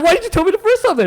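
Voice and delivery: very high mock protest; exasperated retort. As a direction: squeal the complaint in comic disbelief. Repeats retort to mistaken customer.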